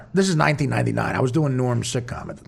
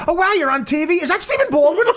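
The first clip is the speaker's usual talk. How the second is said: mock starstruck fan; 13 semitones higher than usual. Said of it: "they'll be like".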